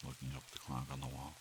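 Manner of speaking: ordinary delivery, nothing marked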